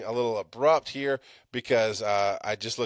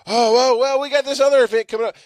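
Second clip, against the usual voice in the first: mock-sweet teacher voice, higher and louder; 12 semitones higher, 7 dB louder than usual. Podcaster mocking the school begging for more money. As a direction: parody the school asking for money. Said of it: "they came with their hand out".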